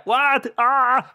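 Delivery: hammed-up horrified cry; mock shock. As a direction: yelp in mock alarm. Shocked reaction quote.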